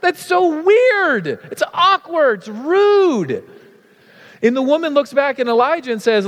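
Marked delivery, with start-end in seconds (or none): 0.0-3.4 mock outrage. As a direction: squeal it out in mock horror at the rude request.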